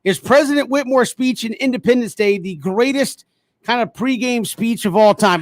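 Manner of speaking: ordinary delivery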